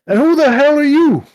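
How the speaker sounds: loud, high, aggressive challenge; hostile shout. Acted. Stranger demanding who the party are, shouting. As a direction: bark it at the intruders.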